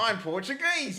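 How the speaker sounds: put-on playful botched Portuguese accent; mock Portuguese accent. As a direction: claim it in a wrong put-on accent. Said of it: "Do the Portuguese accent".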